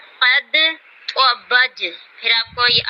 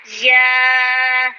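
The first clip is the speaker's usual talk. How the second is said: ordinary talk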